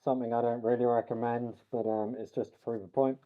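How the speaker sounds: talking through a face mask; muffled voice. Real, not acted. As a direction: speak muffled behind a mask. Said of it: "sorry for the muffled voice".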